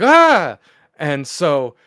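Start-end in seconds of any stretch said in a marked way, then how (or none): 0.0-0.6 a short high startled scream, hammed up; mock scream. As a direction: yelp in fright at the boy milk boy. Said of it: "and she's like".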